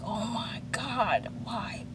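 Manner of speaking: a hushed oh my God; mock whisper. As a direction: whisper it to yourself in disbelief. Stunned reaction to bad timing.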